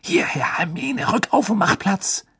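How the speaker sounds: high, whispery urgent plea; frantic hushed beckoning; urgent hiss. Acted. Ron hissing urgently for room.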